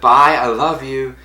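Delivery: loud, high, drawn-out farewell; imitated excited fan saying bye; gushing goodbye. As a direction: call out a slow, loving goodbye. Another fan's parting call acted out.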